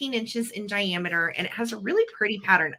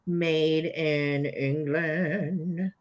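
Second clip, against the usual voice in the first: slow, drawn-out put-on British accent; 4 semitones lower, much slower than usual. Showing off the English tray to viewers, slow playful British accent. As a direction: savour each word in a playful British accent. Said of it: "'Cause I can do my British accent".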